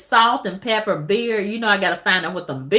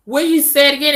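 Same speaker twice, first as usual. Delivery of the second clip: higher, pressing the question again; insistent repeat; 6 semitones higher, 4 dB louder, much faster than usual. Real, not acted. Vlogger pressing her viewers to repeat it. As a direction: push the question harder the second time.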